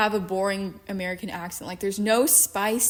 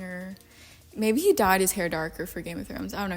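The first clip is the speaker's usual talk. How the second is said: ordinary delivery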